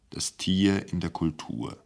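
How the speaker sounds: slow whisper; whispered title. Acted. Narrator hushes the name of the department, in a whisper. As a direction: breathe the title out with mock reverence.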